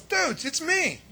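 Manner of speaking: Mary at the door. Acted. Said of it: "And then Mary goes".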